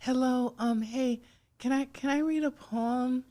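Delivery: put-on high, hesitant little-kid voice; childhood self. Guest re-enacting how she sounded to people, high kid voice. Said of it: "kinda reflect on how I sounded to people".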